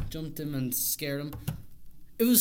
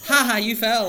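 loud mocking laugh at a fall; jeering taunts; 6 semitones higher, 10 dB louder than usual. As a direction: jeer at someone who fell. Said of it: "She goes like".

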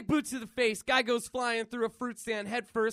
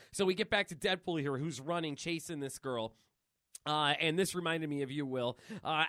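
ordinary delivery

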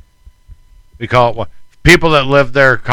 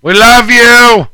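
loud, drawn-out shout of love; calling out; 11 semitones higher, 10 dB louder, slower than usual. Real, not acted. Shouting love to the caller on the phone, loud call. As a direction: call it out high across the room.

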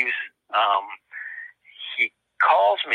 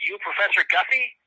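put-on Southern accent; 7 semitones higher than usual. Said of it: "this guy with a thick Southern accent".

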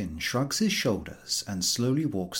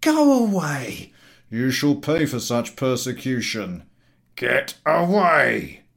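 loud, slow outrage; revolted public; 4 semitones higher, 7 dB louder than usual. Acted. Passengers to the collector, revolted. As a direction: spit out disgust.

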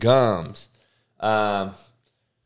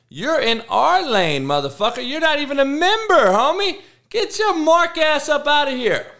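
heated call-out. Real, not acted. Calling out rival, heated.

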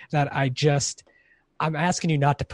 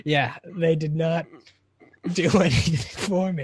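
ordinary delivery

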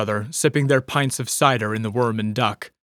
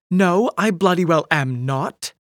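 put-on higher, slower drunken protest; tipsy indignant denial; 5 semitones higher than usual. A narrator's reading. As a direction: deny it a bit too hard. Tipsy Polly denying being drunk.

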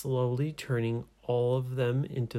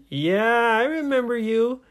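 high, loud reply to someone overhead; raised storybook-hero voice; calling up; 11 semitones higher, 6 dB louder than usual. Acted. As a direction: answer so it carries up the tree. Hero calls up to pirate.

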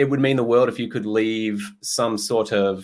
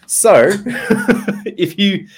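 high, loud words through laughter; laughing; 12 semitones higher, 7 dB louder, much slower than usual. Real, not acted. Host signing off after joking promises, laughing. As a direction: try to carry on while cracking up. Host cracking up at own joke.

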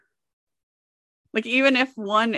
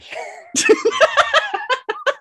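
high crying laugh; shrieking laugh; 5 dB louder than usual. Real, not acted. Laugh alone.

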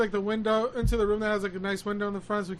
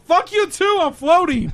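higher and louder, laughing defiance; stoned outburst; 9 semitones higher, 10 dB louder than usual. Retold or imitated. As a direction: yell it back at your sister, giggling. High teller snapping at sister.

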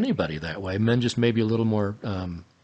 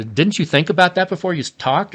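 higher, louder mock accusation; insulting question; 6 semitones higher, 5 dB louder than usual. Shown as a demonstration. Husband acting out a put-down question for his wife, mock accusing. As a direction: throw it at him like a jab. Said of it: "it's an insult in a question".

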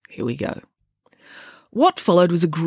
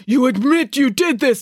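put-on higher, accusing amateur Russian accent; demanding Russian accent; 9 semitones higher, 4 dB louder than usual. A narrator's reading. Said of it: "demanded Mister Popov".

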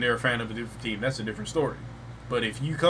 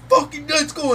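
very high, loud touchdown yell; celebrating yell; 7 dB louder than usual. Retold or imitated. Fan yelling at a Steelers touchdown, loud and high. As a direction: explode at the touchdown.

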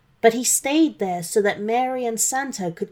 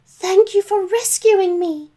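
much higher, louder grateful woman's voice; Mary grateful; 11 semitones higher, 6 dB louder than usual. Acted. Mary thanking Santa and Rudolph, high and grateful. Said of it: "cried Mary".

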